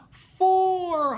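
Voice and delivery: high, loud cheerful count; broad singsong kids theatre. Acted. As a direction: announce it to the kids. Counting with children.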